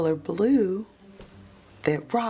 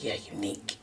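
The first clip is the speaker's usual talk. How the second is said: whispered, low and soft; hushed aside; 8 semitones lower, 9 dB quieter, faster than usual. Real, not acted. Telling viewers the suspect, whispered aside. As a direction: drop to a secret whisper.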